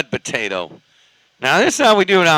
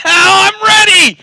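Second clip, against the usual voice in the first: excited laugh; 5 dB louder than usual. Real, not acted. Delighted by food talk.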